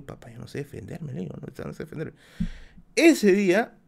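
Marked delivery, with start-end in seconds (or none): 0.0-2.1 a small, much softer plea; timid reply. Retold or imitated. A boy telling his military father he can't fight, in a small soft voice. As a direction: say it quietly, ashamed.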